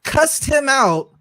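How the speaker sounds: worked-up retelling; excited outrage. Real, not acted. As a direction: raise it up as it gets worse.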